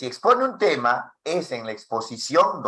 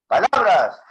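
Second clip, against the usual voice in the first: loud, raised-pitch word; loud volume; 5 semitones higher, 6 dB louder than usual. Shown as a demonstration. Teacher repeating a volume demo, loudly. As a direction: project it strongly. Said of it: "Te has dado cuenta que está el volumen".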